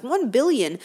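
ordinary talk, nothing marked

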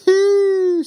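high, loud sudden "Hee!"; surprised exclamation. Acted. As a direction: yelp it out in surprise. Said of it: "she exclaims".

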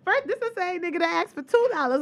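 real amused incredulity; laughing disbelief. Real, not acted. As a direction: laugh while calling it absurd. Mocking the man, laughing.

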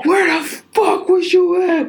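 high furious yell, slowed and spat out; mock the angry boyfriend's high yell. Quoting the angry boyfriend at the club, a high yell. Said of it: "his voice was so loud".

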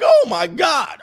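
high, loud mock panic; mock shriek. Women getting what they asked for, a hammed-up shriek. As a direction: shriek like it's too much.

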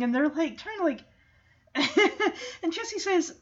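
ordinary talk, nothing marked

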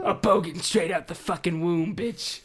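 mock Australian bogan. Plays a newborn Australian bogan.

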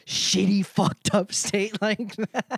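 high, drawn-out, laughing delivery; laughing through it. Real, not acted. Comedian trashing some state as a bit, laughing. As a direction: crack up while slagging it off.

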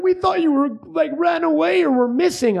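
hammed-up tearful relief; frantic parents. Frantic parents voiced in story.

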